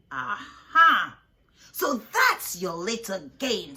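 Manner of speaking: loud triumphant witchy teacher; a strict teacher gloating over a found mask; gloating discovery. A narrator's reading. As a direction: catch them out with relish. Miss Swamp finds the mask.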